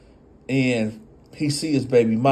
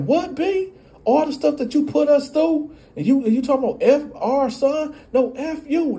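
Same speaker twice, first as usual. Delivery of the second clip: very high shrill-pitched rage; hammed-up angry mother; furious mock yell. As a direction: tear into him. Argument escalates.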